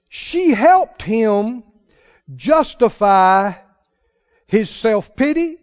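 loud, very slow preaching; loud slow preacher cadence. Real, not acted. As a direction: drive each word home. Preaching at full force.

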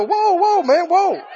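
high, startled "whoa, whoa"; mock backing away. Preacher mimicking himself backing off from a city talker. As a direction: wave off a close talker, half laughing. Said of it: "I'm wanting to go".

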